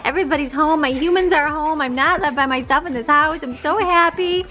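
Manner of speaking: high, slow, gushing dog voice; voicing the happy dog. Mom voicing her dog's joy at everyone coming home, high and drawn out. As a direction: speak for the overjoyed dog, drawn out. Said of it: "She said".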